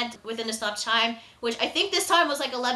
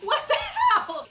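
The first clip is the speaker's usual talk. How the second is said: laughing disbelief; 5 semitones higher than usual. Real, not acted.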